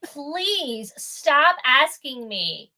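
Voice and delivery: drawn-out, weary plea; exasperated plea. Retold or imitated. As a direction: stretch it out, tired of being asked. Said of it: "And I'm like".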